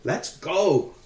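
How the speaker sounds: put-on high, lively little-girl call; child's shout. A narrator's reading. Tiny girl urging George to set off, shouted.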